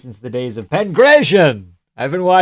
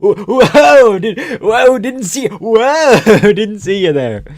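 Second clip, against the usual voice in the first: mock surprise; 9 semitones higher, 7 dB louder, slower than usual. Mock startled double take.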